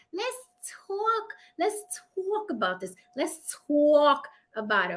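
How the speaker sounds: thick New York accent. Shown as a demonstration. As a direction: say it like a New Yorker. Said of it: "My New York accent".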